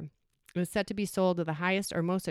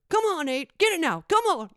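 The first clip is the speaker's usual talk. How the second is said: high, loud crowd yell; cheering shout; 11 semitones higher, 8 dB louder than usual. Retold or imitated. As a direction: roar your horse home. Crowd roaring at the finish.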